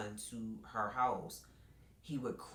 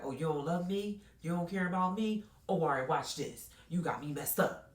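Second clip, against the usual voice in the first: higher-pitched, mock-offended challenge; retold confrontation, pitched up and heated; acted indignation; 7 semitones higher, 4 dB louder than usual. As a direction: act out someone indignantly throwing down. Acts out her angry confrontation.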